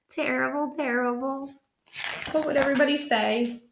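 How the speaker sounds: understated mock dismay, tailing on; fretting mother. A narrator's reading. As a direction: keep fretting under your breath. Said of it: "You know how mothers do that".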